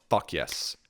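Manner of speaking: ordinary talk, nothing marked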